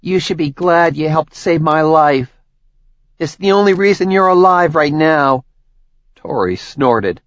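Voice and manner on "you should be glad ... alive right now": higher, louder contemptuous threat; sneering. Acted. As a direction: sneer it with disdain. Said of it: "Medea sneered at her".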